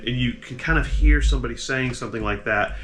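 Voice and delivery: normal talking voice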